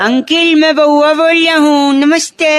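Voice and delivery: lightly put-on childish Baua greeting; Baua character voice. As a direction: play the silly boy Baua. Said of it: "बउआ बन के".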